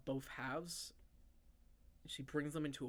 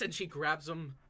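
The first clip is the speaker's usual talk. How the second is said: normal talking voice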